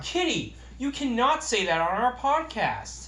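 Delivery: high, loud, slow mock reprimand; mock scolding. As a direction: playfully tell off the kitty.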